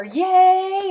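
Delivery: high loud cheer; mock cheer for baby. Shown as a demonstration. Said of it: "clap your hands together".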